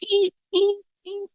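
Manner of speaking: very high squeaking of cart wheels; squeaky wheels. Retold or imitated. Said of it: "wheels are going".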